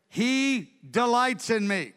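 slow, high, wholehearted proclamation; emphatic declaration. Acted. David tells why God rescued him, jubilant and emphatic. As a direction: proclaim it slowly with joy.

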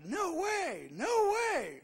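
very high, exaggerated incredulous squeal; mock disbelief. Younger self reacting to Psalm fifty-six, high mock disbelief.

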